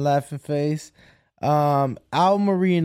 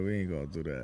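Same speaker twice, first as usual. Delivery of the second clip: much lower, softer repeat; very low mock refusal; 14 semitones lower, 13 dB quieter, faster than usual. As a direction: drop it way down low.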